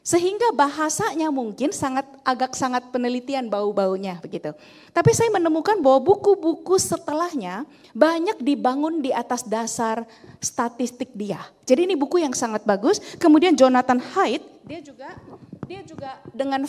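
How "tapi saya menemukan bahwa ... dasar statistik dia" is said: normal talking voice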